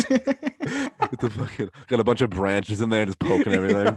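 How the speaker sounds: words squeezed out through real laughter; laughing hard while getting the words out. Real, not acted. Cracking up at his friend's brain joke, laughing.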